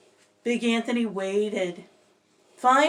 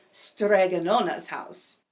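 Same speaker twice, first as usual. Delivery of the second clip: plain reading voice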